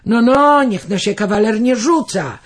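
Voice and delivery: wyżej i głośniej, opryskliwie; odburknięcie. A narrator's reading. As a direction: odburknij zaczepnie jak furman. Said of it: "odburknął woźnica". (woźnica odburkuje Andrzejowi, wyżej i głośniej)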